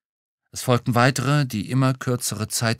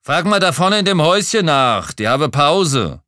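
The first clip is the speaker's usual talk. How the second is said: loud, high bellowed directions; rough-and-ready yelled answer; shouting back; 8 semitones higher, 9 dB louder than usual. A narrator's reading. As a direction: holler directions from the wagon. Worker shouts back over noise.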